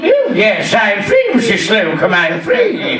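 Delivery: camp sing-out with slurred sibilants; John Inman. Acted. Comedian doing a TV star's catchphrase for the audience, camp impression. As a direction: play the camp comedy shop assistant. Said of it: "Do you remember John Inman".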